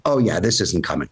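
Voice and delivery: low, dismissive verdict, imitating a wife. Wife rules on his furniture, mock dismissive.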